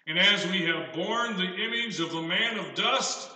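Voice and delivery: measured pulpit reading of the verse; scripture reading. Acted. Preacher reading the Bible passage aloud, measured. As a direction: lay each phrase out for the congregation.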